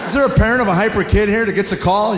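usual speaking voice

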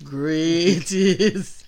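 put-on low African-accented voice; mock African accent. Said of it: "Do the African voice".

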